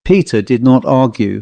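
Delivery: ordinary delivery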